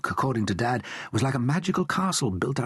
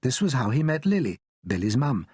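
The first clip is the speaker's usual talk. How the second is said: ordinary delivery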